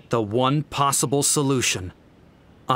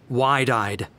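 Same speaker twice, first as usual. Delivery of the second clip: ordinary delivery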